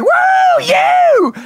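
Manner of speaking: a high, loud, whooping cheer from the crowd; fired-up whoop. Retold or imitated. Fan at a motivational speech cheering the speaker, whooping shout. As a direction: let out a wild woo like you're in the front row.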